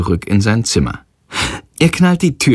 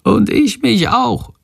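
high, tiny creature voice, said slowly; a small bat chiming in, much higher; little bat voice; 12 semitones higher, slower than usual. A narrator's reading. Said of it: "flüstert leise Shandor".

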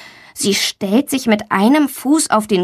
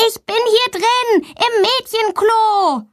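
high, slow call; 13 semitones higher, slower than usual. A narrator's reading. Girl tells the footsteps where she is, high call. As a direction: keep calling so they find her, high. Still calling for help.

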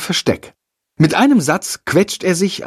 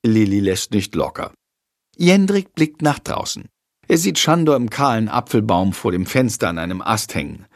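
ordinary delivery

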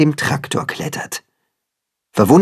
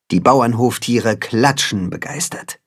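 ordinary delivery

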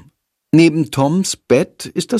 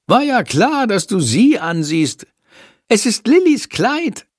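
much higher, knowing bat voice; teasing little bat; 11 semitones higher than usual. Acted. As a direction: caught you looking, tease him. Sándor teases Jendrik.

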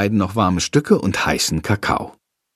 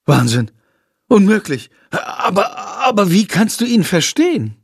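excited outburst, higher at the end; blurting out; 7 semitones higher than usual. A narrator's reading. Lilly bursts out in amazement at Jendrik, excited. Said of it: "Aus Lilly platzt es heraus".

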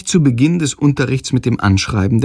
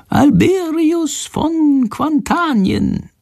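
very high, slow prompting; slowly feed him the name, bird voice; whispered prompt from the bird; much slower than usual. A narrator's reading. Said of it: "Schandors leise Stimme hinter der Kaffeekanne vorsagen".

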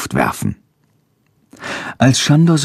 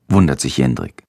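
ordinary delivery

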